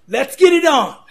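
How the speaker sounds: loud, high fight-starting call; Big John McCarthy's call. Retold or imitated. Referee starting the fight, loud and high. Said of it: "in his inimitable style".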